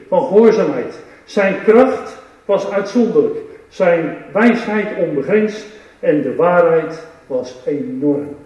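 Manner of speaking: ordinary delivery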